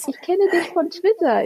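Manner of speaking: high, laughing, gushing fan; excited fan voice. Retold or imitated. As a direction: gush like a starstruck stranger. Imitates excited fans.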